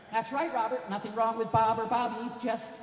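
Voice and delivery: high-pitched mom interjecting; approving mother. Retold or imitated. Mom backing up her rule to her son, emphatic. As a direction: chime in high as his mother. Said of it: "according to my mom".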